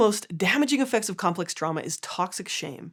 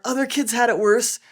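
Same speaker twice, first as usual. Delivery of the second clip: loud, higher-pitched protest with effort; acted denial under strain; 4 semitones higher, 8 dB louder than usual. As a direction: insist while straining against a heavy load. Acts out resisting grief, brick held.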